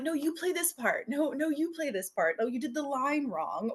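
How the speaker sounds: put-on bossy kid directing. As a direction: play the kid running the reenactment. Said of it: "It's like".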